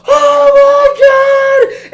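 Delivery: full-force, drawn-out high scream; Peter screaming. Retold or imitated. Speaker imitating Peter halfway down the first drop, screaming. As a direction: scream it long and loud like a rider on the drop. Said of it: "Peter's screaming at the top of his lungs".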